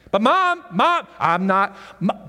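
high, loud, drawn-out "But Mom"; Jesus protesting to his mother like a teenager; whining son. Acted. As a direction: whine in protest like a kid.

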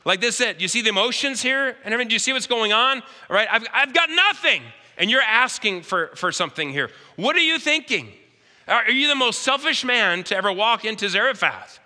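rising to high, loud cries of why me; the widow losing patience with the prophet; exasperated widow. Retold or imitated. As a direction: build from sharp complaint to a desperate outcry. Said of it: "Maybe she's going".

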